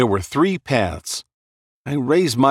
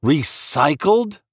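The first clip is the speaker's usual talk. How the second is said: slow, shocked echo; stunned disbelief; 4 semitones higher, slower than usual. A narrator's reading. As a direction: repeat it in disbelief. Narrator echoes in shock.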